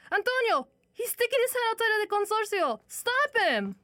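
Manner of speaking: panicked mother calling; mother seeing her son in danger; alarmed shout. Acted. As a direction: cry out in alarm. Mother sees child in danger.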